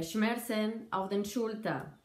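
ordinary talk, nothing marked

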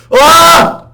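sudden high yell; real startled yell; startled scream. Real, not acted. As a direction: scream out in shock. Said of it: "Oo, bağırmış olabilirim".

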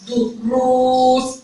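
slow lengthened دروس; drawn-out oo in duroos. Shown as a demonstration. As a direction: stretch the long uu of دروس very slowly. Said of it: "ومديت في الراء".

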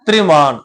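ਤ੍ਰਿਮਾਨ as "tri-maan", t running into r; ਤ੍ਰਿਮਾਨ starting with a joined tr; vowel on the r. Shown as a demonstration. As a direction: pronounce ਤ੍ਰਿਮਾਨ with the short i after the r. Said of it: "ਰਾਰੇ ਨੂੰ ਸਿਹਾਰੀ ਆਪਾਂ ਉਚਾਰਨ ਕਰਨੀ ਹੈ".

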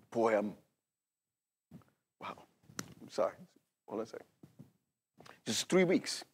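real emotion, soft and halting; choked up. Real, not acted. Preacher halting over his ailing dad, choked up. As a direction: struggle to get words out, with long pauses. Overcome speaking of his father.

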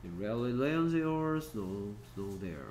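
very slow, word by word; slow read-aloud. Shown as a demonstration. Teacher reading the rain sentence slowly to students. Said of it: "teacher reads sentence aloud".

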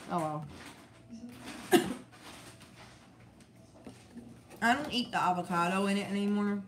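talking between bites; chewing while talking. Real, not acted. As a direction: mouth partly full. Seen 2: Lips pressed, jaw working between phrases; she was eating earlier.

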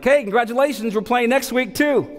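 high, bright parent cheer; chirpy parents. Retold or imitated. Other parents cheering after each win, high and chirpy. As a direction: chirp it like upbeat soccer parents. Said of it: "they're like".

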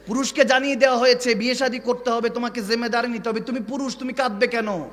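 forceful quick-fire preaching; insistent preaching. Acted. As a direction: press the point home, high and urgent. Preaches in a raised voice.

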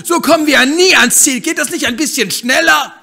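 high, loud, bubbly hen voice; lively bossy hen. Acted. Hen eager to leave the backyard, loud and high. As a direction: push ahead full of cheer.

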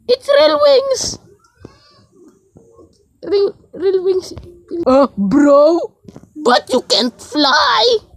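high, squeaky voice insisting, rising to a shout; a petty character claiming to be the real angel. Acted. Two angels bickering over who is real, in a squeaky voice. Argument about wings.

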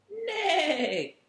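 high loud whinny with a laugh; whinny like a horse; horse neigh. Pretend. Host reading to kids, neighing like the blue horse.

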